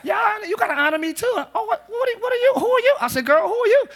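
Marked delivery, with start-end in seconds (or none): 0.0-1.3 high little-girl voice, imitated; young daughter. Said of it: "My daughter tell me". 1.4-3.0 very high, stammering mock shock; mock disbelief. Acted dispute with young daughter. 3.2-3.8 high, loud mock-indignant question; mock disbelief. Acted dad challenging daughter.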